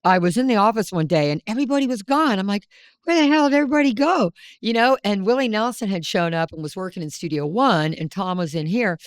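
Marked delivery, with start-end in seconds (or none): 3.1-4.3 exasperated. Retold or imitated. Retold exasperated quote, high fast.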